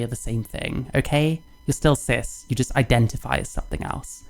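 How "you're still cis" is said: usual speaking voice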